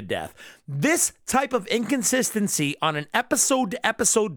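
high-pitched, exasperated tirade; pissed-off rant. Real, not acted. Reviewer fed up with episode-to-episode inconsistency, pissed off. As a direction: vent real frustration, pitch riding high. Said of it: "how pissed off my voice sounds".

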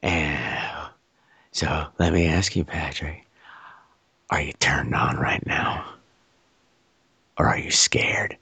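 low, slow, creepy whisper; husky voice. Retold or imitated. Stranger questions his pinned victim, husky whisper. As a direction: murmur the questions deep and soft. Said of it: "there's this husky voice in my ear".

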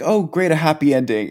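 quick, bright, sarcastic cheer; sarcastic pleased reader at a happy ending; mock relief. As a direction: toss it off fast like a fake celebration. Reacting to the comic's ending.

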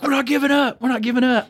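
high, lightly voiced defiant holdout; a hint of a stubborn inmate refusing. Holdout inmate refusing to surrender to officers, mild mock defiance. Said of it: "And he's like".

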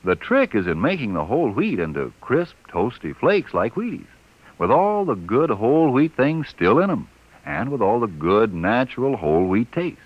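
upbeat radio commercial pitch; ad read. Acted. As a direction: sell the cereal brightly.

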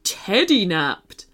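slow, deliberate put-on teddy voice; correcting teddy. A narrator's reading. Teddy Marshall supplies the proper word, pedantic. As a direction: pronounce the corrected word with care. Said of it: "corrected Marshall".

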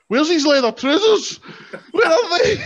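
high, loud frantic shout; panicked Kevin Nash. Retold or imitated. Host voicing Kevin Nash panicking backstage, high and loud. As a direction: shout it like a lost wrestler panicking. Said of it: "he's running about going".

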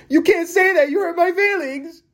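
very high, near-crying whine; mock crybaby. Vlogger mocking offended people, high fake crying whine. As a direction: wail it like a hurt crybaby. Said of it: "community that's saying".

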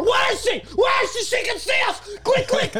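high panicked shouting; panicked yell. Acted. Panicked agent in Bourne scene.

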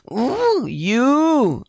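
high, loud excited "Ooh, you"; delighted squeal. Real, not acted. Reacting to Susie J comment.

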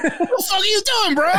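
high-pitched, incredulous laughing protest; mock disbelief. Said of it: "And I'm like".

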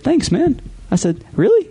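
high, squeaky disbelieving thanks; surprised. Retold or imitated. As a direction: sound floored by the compliment. Shocked by compliment.

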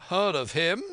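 high, loud, incredulous question; indignant cry. A narrator's reading. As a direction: exclaim it as the astonished squire. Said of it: "cried the squire".

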